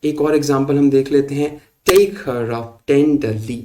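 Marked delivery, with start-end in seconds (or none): 1.9-3.6 very slow, "take" and "ten" heavy, the rest light; DUM-da-da DUM-da-da; scanned verse, slowly; dactylic reading. Shown as a demonstration. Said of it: "इसमें भी unaccented".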